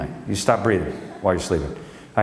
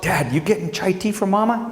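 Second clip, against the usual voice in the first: higher lightly voiced child; kid voice; 9 semitones higher than usual. Son Grayson asking his dad about Mama's chai. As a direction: ask like an eager little boy. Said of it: "I said to Grayson".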